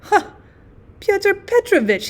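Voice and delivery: high, glad exclamation; a bright burst of joy at a familiar face; delighted. A narrator's reading. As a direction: recognize an old friend with delight. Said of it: "claps his hands with delight".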